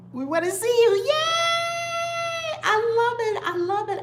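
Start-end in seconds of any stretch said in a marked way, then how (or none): 1.1-4.0 high, loud happy shout; cheering. Real, not acted. Cheering a viewer's news, with a high happy shout.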